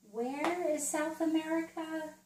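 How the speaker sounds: high, lilting question to a little one; quizzing a child. Shown as a demonstration. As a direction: ask it in a high, teacherly lilt. Models quizzing a young child.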